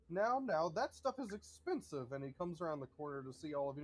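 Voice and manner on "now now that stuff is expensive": tut-tut like a house servant; fussy butler. Acted. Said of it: "and he says".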